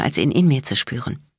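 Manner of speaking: ordinary delivery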